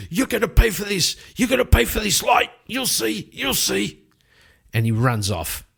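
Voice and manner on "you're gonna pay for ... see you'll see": much higher, squealing sore loser; whiny threat. Acted. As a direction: shriek the threat as you scramble up. Thrown-out man threatens revenge.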